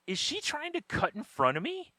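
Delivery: high-pitched incredulous question; retold incredulity. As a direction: let disbelief push the question up high. Retelling indignant reaction to queue-jumper.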